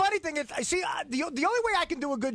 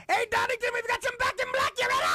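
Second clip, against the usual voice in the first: high shouted rock-singer yell; 13 semitones higher than usual. Retold or imitated. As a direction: scream it to a stadium crowd. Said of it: "All he would say to the audience was".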